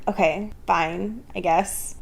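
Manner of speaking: soft, slow, reluctant sigh of a reply; grudging Bella. Retold or imitated. Bella giving in to Edward, soft and reluctant. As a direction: give in half-heartedly. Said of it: "And she's like".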